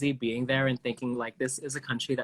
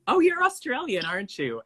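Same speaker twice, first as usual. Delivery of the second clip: much higher, louder mock-delighted question; mistaken eager guess; 11 semitones higher, 3 dB louder than usual. Mistaking British people for Australians, eagerly guessing. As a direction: exclaim it high, then drop on the tag. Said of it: "always am like".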